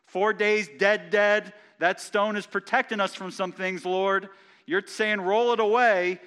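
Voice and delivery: voicing Martha's protest. Preacher voices Martha's alarmed objection.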